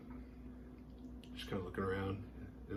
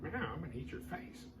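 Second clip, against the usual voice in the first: higher, softer sinister kitty; mock cat voice; 6 semitones higher, 9 dB quieter than usual. The cat finding the body, voiced as a softly menacing kitty. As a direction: play the cat as a creepy little predator. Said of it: "It's like".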